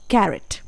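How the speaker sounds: कैरेट repeated as "ka-ret"; pronunciation demo. Shown as a demonstration. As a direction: say कैरेट again clearly. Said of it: "एक बार फिर से".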